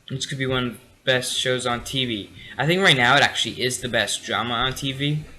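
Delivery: normal talking voice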